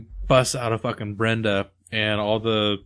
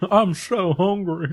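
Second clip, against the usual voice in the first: whiny hungry voice; 8 semitones higher, slower than usual. Retold or imitated. Quoting the hungry character.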